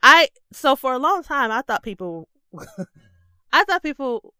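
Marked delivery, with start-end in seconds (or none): none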